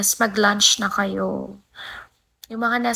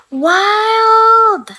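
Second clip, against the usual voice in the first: high, loud, drawn-out squeal; excited shriek at a gift; thrilled cry; 11 semitones higher, 10 dB louder than usual. Real, not acted. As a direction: cry it out in amazed delight. Reacting to a viewer's gift.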